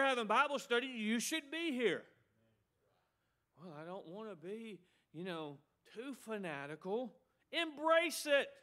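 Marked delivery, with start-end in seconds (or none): 3.6-7.2 much softer, hesitant mock objection; reluctant excuse. Preacher mimics a member avoiding commitment, soft reluctant excuse. 7.5-8.5 high, slow preacher's exhortation; emphatic command. Real, not acted. Preacher answering a reluctant member, emphatic command.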